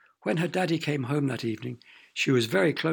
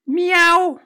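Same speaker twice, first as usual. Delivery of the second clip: very high, loud meow; sudden loud yowl of a cat; awful cat screech; 14 dB louder than usual. A narrator's reading. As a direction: screech out a piercing cat's meow. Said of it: "The awful screech came from behind".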